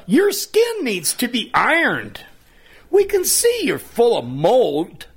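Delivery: high, teasing taunt; mocking young staff. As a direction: jeer at the old man. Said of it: "They giggled at me and said".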